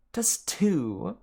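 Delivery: high slow female snake; Martha the snake. Acted. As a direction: brush George off sweetly. Martha retorting.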